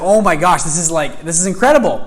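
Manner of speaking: loud, high retold astonishment; mind-blown amazement. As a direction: gush as if stunned by a discovery. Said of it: "my mind was just blowing".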